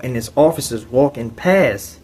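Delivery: ordinary talk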